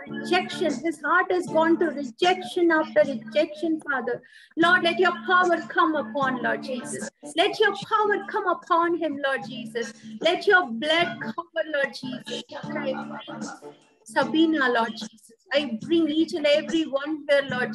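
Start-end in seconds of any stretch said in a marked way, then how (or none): none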